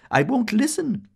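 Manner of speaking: high, loud refusal; crying out. A narrator's reading. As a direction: squeal it with fingers in your ears. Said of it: "she cried".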